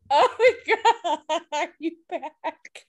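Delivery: giggling through the words, pitch jumping up; real laughter bubbling over the question; laughing at a frozen screen. Real, not acted.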